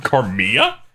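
"Carmilla" echoed as car-MEE-a, rising in disbelief; "Carmilla" as car-MEE-ya, questioning the Spanish-style ending; Spanish pronunciation, questioned. Retold or imitated. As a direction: repeat "Carmilla" as a question with no L sound. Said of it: "That's how you pronounce it in Spanish".

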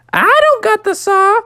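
put-on very high tiny baby frog voice; baby sister. Baby sister frog denying she has the saw, squeaky. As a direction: squeak it like a toddler.

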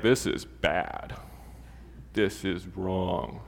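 low, slow, drawn-out dread; grave alarm. Acted. Kids sensing a sweating parent's fear, gravely alarmed. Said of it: "they're gonna be like".

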